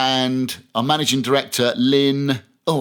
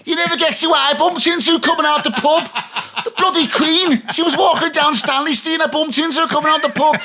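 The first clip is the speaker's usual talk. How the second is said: high, laughing, excited bloke; a drunk man telling his wife about the Queen, high and laughing; excited drunk husband; 14 semitones higher, 3 dB louder than usual. Retold or imitated. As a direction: blurt it out to the wife, giddy and tipsy. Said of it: "getting home to his wife and saying".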